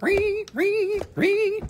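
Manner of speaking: very high squeaky ree; squeaky ree noise. Pretend. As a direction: squeak it like a toy. Imitating a squeaky sound.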